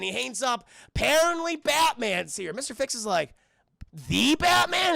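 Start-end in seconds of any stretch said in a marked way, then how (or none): 4.1-5.0 loud, high mock disbelief; incredulous. Streamer voicing Mister Fix hearing of Batman, incredulous. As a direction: punch it out as a disbelieving question.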